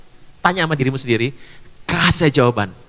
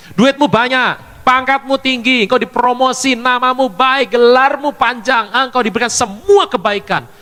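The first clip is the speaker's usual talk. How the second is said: slow, higher proclaiming list; slow rousing sermon list; emphatic preaching; 6 semitones higher, 3 dB louder than usual. Acted. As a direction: spell out each blessing with weight. Preacher lists blessings slowly.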